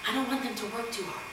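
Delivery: hushed little excuse; very quiet mom. Retold or imitated. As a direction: imitate a timid mother not wanting to bother anyone. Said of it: "That's how she talked".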